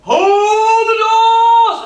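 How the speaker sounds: shouted from afar. Retold or imitated. Woman down the corridor bellowing at the closing lift. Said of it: "this massive voice from down the corridor around the corner went".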